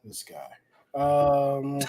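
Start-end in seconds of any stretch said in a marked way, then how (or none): 0.1-0.5 low, soft dismissive aside; muttered mock disbelief. Real, not acted. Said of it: "Why you said it like that?".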